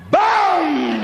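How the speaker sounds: sharp high "Boom!" for a pistol shot; imitated gunshot. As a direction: bark out a gunshot sound.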